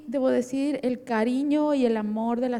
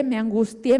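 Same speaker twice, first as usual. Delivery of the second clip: normal talking voice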